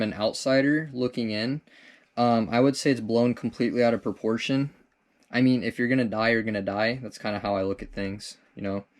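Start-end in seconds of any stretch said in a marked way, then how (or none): none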